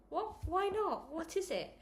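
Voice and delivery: high, surprised rapid questions; startled questions. Acted. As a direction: sound alarmed and puzzled. Mum alarmed, questioning.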